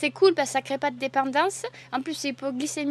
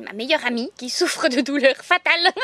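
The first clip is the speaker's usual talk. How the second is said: ordinary delivery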